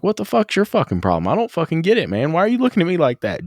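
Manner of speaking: high, fast profane outburst; hammed-up fury at the boss; mock angry rant. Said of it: "He's like".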